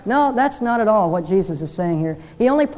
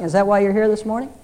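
usual speaking voice

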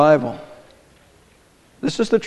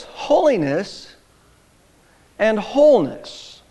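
holiness and wholeness drawn out slowly, side by side; preacher lingers on holiness and wholeness to match them; slow, stressed; 3 semitones higher, slower than usual. Shown as a demonstration. Preacher to congregation, drawn-out stress on both words. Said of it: "if you'll notice".

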